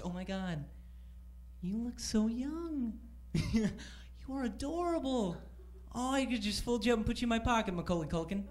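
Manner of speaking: soft, high gushing imitation; mock cooing. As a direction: coo softly as if over a cute baby. Imitates people cooing over him.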